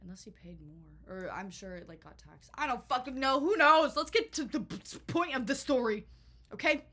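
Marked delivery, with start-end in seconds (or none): 2.5-6.8 loud, higher, fed-up rant; loud exasperated who-knows; exasperated outburst. Real, not acted. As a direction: throw your hands up and shout it off. Exasperated outburst over the price.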